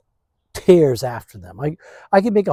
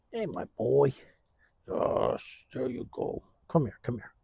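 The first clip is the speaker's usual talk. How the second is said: soft, near-whispered coaxing to the dog; murmuring to his dog; hushed dog talk; 10 dB quieter than usual. Real, not acted. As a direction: hush and settle the dog gently.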